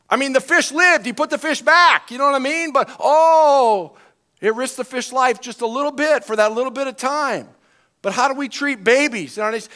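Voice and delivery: high, loud and excited; worked-up preaching. Real, not acted. Pastor carried away preaching about devalued life, excited. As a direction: build to a loud, emphatic outcry. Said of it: "I'm getting excited right now".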